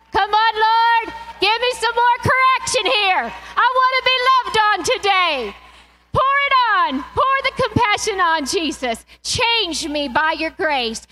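loud, high, crying-out prayer; impassioned worship cry; fervent prayer. Real, not acted. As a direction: plead with God at full force.

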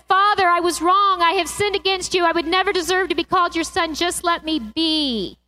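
pleading son. Acted.

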